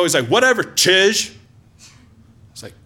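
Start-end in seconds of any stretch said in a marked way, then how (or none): 0.3-1.3 mock yell. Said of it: "starts yelling at me in Navajo".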